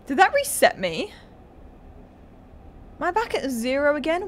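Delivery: ordinary delivery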